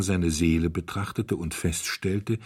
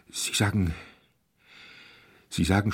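halting, broken-off false starts; falter, stop and try once more; stammering. A narrator's reading. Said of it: "stotterte er". (stotternd, stockend)

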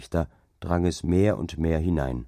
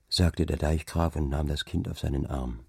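plain reading voice

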